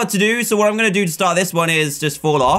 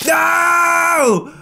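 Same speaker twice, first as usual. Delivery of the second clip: anguished shout; 9 semitones higher than usual. Real, not acted. Gamer wailing as the character falls short, anguished shout. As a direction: wail no as the jump fails. Player fails near the end.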